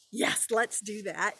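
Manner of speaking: usual speaking voice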